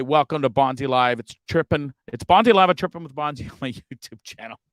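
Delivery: normal talking voice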